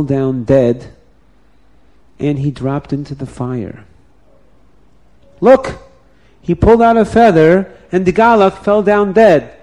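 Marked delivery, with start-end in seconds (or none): 5.4-9.5 shocked cry. Acted. Mob crying out in shock after the priest falls dead.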